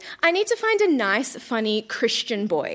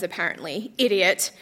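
usual speaking voice

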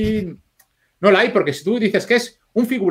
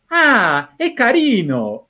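higher, pleased "Ah, es carino", drawn out slowly; charmed approval; 5 semitones higher, much slower than usual. Retold or imitated. An editor reacting fondly to the driver's words, mildly imitated with pleasure. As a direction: coo "carino" as if the man is sweet. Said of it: "lo iba viendo lo que decía el prota y decía".

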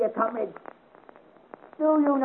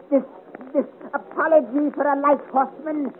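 usual speaking voice

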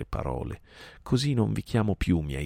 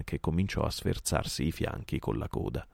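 usual speaking voice

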